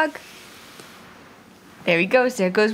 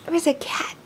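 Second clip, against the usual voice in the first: whisper; 3 semitones higher, 6 dB quieter than usual. Real, not acted. Unvoiced to toddler.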